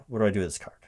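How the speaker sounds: normal talking voice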